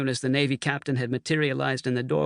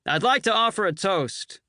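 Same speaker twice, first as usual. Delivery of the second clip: higher call over the noise; shouted toast; 7 semitones higher than usual. A narrator's reading. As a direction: cut through the din. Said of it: "Bull shouted above the din".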